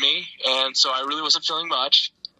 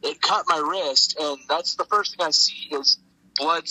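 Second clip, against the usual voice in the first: ordinary talk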